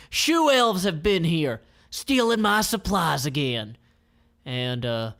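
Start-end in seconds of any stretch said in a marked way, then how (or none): 0.1-3.8 put-on old shoemaker. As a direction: grumble like an old shopkeeper. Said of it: "the old guy ... is like".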